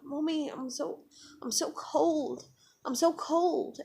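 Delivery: put-on high, plaintive little child; small high-pitched "mommy" voice; retold toddler voice. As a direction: imitate a toddler talking in her sleep. Retelling two-year-old's sleep talk.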